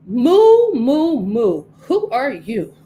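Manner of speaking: a storybook cow calling out. Acted. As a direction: moo it out as a big friendly cow. Talking cow greets Pedro.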